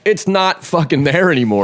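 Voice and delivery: high-pitched mock exasperation; exasperated insistence. Workers to head guy about the core, exasperated. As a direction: imitate a worker at wit's end with denial. Retold workers argue with boss.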